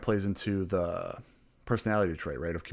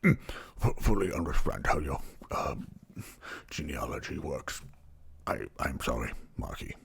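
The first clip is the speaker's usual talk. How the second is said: ordinary talk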